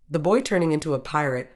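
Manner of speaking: plain reading voice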